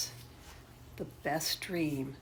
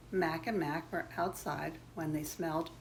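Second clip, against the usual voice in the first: ordinary delivery, nothing marked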